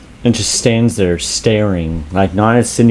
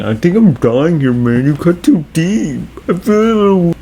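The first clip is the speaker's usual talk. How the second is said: whiny, rising mock agony; hammed-up dying complaint; mock dying Stu; 8 semitones higher than usual. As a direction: moan higher and higher as if wounded. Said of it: "Stu was saying".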